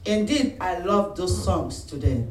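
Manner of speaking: usual speaking voice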